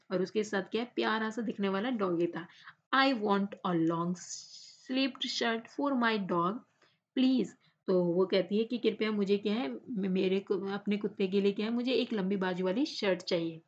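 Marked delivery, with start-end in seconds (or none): 2.9-7.5 slow, careful read-aloud for the class. Shown as a demonstration. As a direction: read each word out slowly and clearly. Teacher reads English text aloud.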